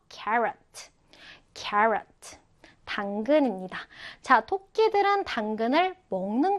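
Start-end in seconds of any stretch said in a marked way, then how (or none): 0.1-2.4 careful model reading of "carrot"; model pronunciation. Shown as a demonstration. Teacher modelling "carrot" for learners, careful pronunciation. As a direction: say "carrot" as a clear, separate word to copy. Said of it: "자, 발음해 볼까요?".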